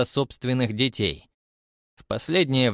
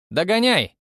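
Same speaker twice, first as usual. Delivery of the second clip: louder, higher shout over the wind; playful call; 8 semitones higher, 4 dB louder than usual. A narrator's reading. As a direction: call out as you run off. Dashes off, calling back.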